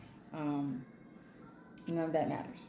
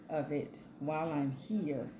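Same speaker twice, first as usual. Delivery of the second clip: ordinary delivery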